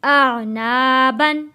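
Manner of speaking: very slow, with "ना" drawn out; stretched recitation. Shown as a demonstration. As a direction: draw both syllables out long. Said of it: "गुन्ना नहीं किया". ("आ ना" बहुत खींचकर)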